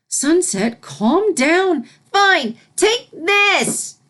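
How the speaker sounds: put-on high, loud child voice; young filly voice. Filly shouting as she zaps the fish. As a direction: play the angry little filly.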